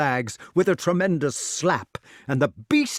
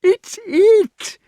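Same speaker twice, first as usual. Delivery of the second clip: very high, loud squeal; high delight; 9 dB louder than usual. A narrator's reading. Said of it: "he shouted in high delight".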